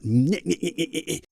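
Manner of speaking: put-on chattering head voice; nagging inner voice. Coach imitating the voices in a client's mind, nagging. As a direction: mimic nagging mental chatter in quick repeated bursts. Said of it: "Do you remember those voices".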